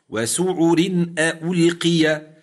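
وسعر ألقي slow, with a thin ر; Quranic recitation. Shown as a demonstration. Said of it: "هل تغير الحكم في هذه الراءات".

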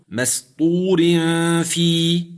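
مسطور في recited with a light ر; Quranic recitation. Shown as a demonstration. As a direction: intone مسطور في with a thin ر. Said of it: "مكسورة".

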